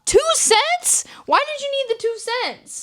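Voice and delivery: high-pitched, baffled questioning; incredulous. Real, not acted. Ranting at a date over his tiny tip, incredulous. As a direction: pitch it way up in sheer disbelief.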